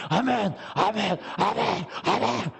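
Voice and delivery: mock fervent amens, each one drawn out; elder's fervent amens. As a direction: imitate an elder punching out amen after amen. 옆 장로님의 아멘을 흉내. (열렬한 아멘 흉내)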